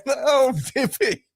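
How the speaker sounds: high, slow mocking call; high mocking Oh Bibby. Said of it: "and saying".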